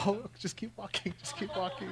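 soft, hushed mutter of "just keep walking"; talking yourself down, hushed and uneasy; nervous self-talk. Retold or imitated. Pastor recalls walking past the cells at San Quentin, hushed and nervous. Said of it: "and I go".

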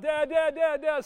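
high, loud calls for dad; a pastor voicing his daughter yelling for him; child crying out. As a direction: call out for dad like a trapped little girl. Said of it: "and she's crying out".

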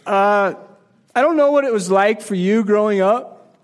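normal talking voice

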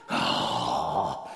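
low, drawn-out groan; weary groan. Acted. Dad called away from the game, groaning low. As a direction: groan like a worn-out parent.

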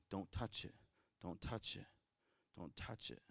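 put-on soft, fading warning to a child; a parent's low warning fading off; quiet warning. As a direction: hush it down each time.

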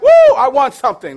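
loud, high victory whoop; excited winner. Acted. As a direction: whoop like you just won. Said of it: "You're excited like".

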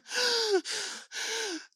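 high, exaggerated gasping for air; breathless wheezing gasps; out-of-breath gasps. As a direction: mimic a winded child sucking in air. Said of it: "completely out of breath".

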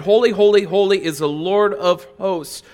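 high, loud call of praise; angelic proclamation. Retold or imitated. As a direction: call it across the throne room. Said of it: "They said".

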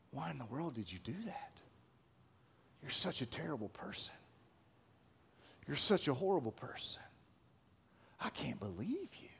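soft, fast, near-whispered scorn; hushed accusing devil. Acted. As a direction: sneer it low and fast, almost a whisper, to wear them down. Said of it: "He changes his tone".

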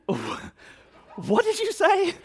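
very high, slow, laughing squeak; mock indignation. Pastor retelling his shocked reply to the cashier, high squeal. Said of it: "And I said".

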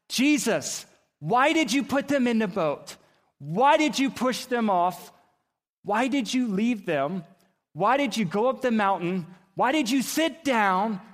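high, emphatic preacher's demand; impassioned questioning. Acted. Preacher asking Jesus about the disciples in the boat, impassioned. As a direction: press each question out forcefully.

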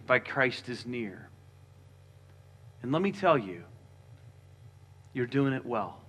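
soft, quiet voice of Paul reassuring the church; gentle reassurance. Retold or imitated. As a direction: speak low and gently, like a pastor calming worried believers.

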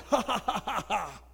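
acted taunting ha-ha-ha; put-on laugh stepping down in pitch; mocking laugh. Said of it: "he laughed at the man".

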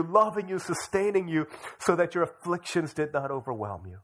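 high, loud, building pulpit voice; impassioned preaching. Real, not acted. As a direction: drive each item home with force. Preaches at full force.

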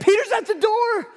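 high, bright excited cry; excited exclaim. Retold or imitated. Rhoda running to the praying crowd, overjoyed. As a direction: blurt it out with joy. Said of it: "and exclaimed".

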